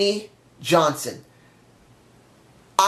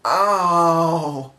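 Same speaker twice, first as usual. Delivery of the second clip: long, drawn-out ow; pained moan; 2 semitones lower than usual. Real, not acted. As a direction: groan it out while still hurting. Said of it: "I just hurt myself".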